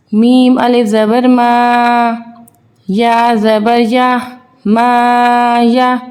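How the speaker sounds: put-on drawn-out sing-song spelling drill for children; teacher slowly spelling out Arabic syllables for young pupils; slow letter-by-letter recitation. Shown as a demonstration. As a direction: chant each letter and vowel slowly, as in a Qaida lesson.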